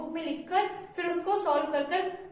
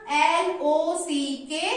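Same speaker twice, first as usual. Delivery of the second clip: slow spelling; much slower than usual. Shown as a demonstration. Teacher spelling lock for pupils, very slow. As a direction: spell L O सी के very slowly. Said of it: "spelling बताई थी".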